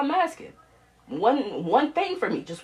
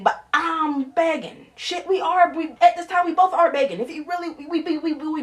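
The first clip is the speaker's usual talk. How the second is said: higher, strained plea; raised begging; 8 semitones higher than usual. Real, not acted. Daughter begging her mother, pitch climbing high.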